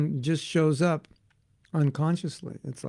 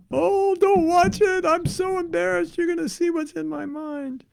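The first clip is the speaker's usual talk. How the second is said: embarrassed friend; 13 semitones higher than usual. Retold or imitated. A devotee begging friends not to watch, high and embarrassed. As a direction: whine it like a mortified devotee. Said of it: "someone else is gonna say".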